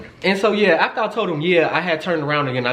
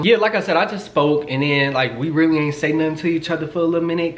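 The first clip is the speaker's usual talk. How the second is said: usual speaking voice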